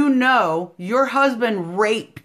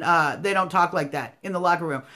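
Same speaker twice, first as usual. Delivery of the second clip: normal talking voice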